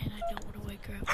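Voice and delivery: fast, very soft whisper. Pretend. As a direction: hush it so nobody wakes. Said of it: "why are you whispering?".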